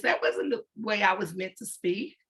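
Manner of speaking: ordinary talk, nothing marked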